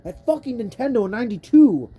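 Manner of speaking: furious gamer cursing at Nintendo; angry rant. Real, not acted. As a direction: rage at the designers at full volume. Rage rant at the game.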